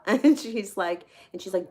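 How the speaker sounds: ordinary talk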